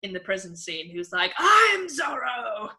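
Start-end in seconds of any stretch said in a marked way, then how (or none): 1.4-2.7 loud, high, drawn-out proclamation; mock heroic shout. Film character in prison claims to be Zorro, mock heroic shout. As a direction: imitate a prisoner calling out slowly and proudly.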